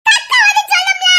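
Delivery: put-on high, childish shouted whine; shrieky tantrum outburst; yelled protest. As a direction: yell it at mother in exasperation.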